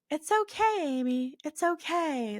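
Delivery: high, loud, bright reassurance from the grandparents; reassuring. Retold or imitated. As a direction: soothe her like a worried grandchild. Grandparents reassuring her in dream.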